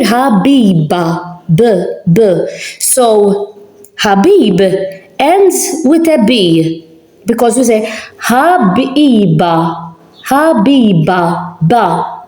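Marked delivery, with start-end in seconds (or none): 0.0-1.4 Habiba slowly with a firm voiced b; clear b sound. Shown as a demonstration. Teacher letting students hear the b in Habiba. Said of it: "So, as you can hear". 10.3-11.6 Habiba slowly with a voiced b; slow b demo. Shown as a demonstration. Teacher modelling the b in Habiba. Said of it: "You can hear the B".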